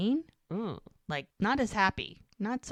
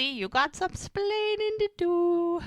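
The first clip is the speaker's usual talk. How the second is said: high, slow mock Cuban scolding; hammed-up sitcom accent; Ricky Ricardo impression; 12 semitones higher, slower than usual. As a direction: drawl it out like Ricky scolding Lucy. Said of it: "And now, Lucy".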